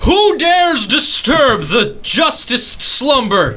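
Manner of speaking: a grand warrior roused from slumber; ancient rasping undead king. Acted. Paladin woken from sleep.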